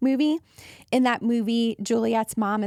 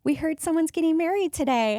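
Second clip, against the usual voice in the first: mom's announcement; 6 semitones higher than usual. Retold or imitated. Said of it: "she's like".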